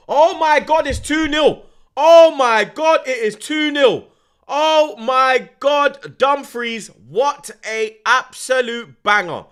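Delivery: screaming high and loud, dragging the words out; screaming goal celebration. Real, not acted. Screaming at the stream as Dumfries scores, ecstatic. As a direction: lose it completely over a wonder goal.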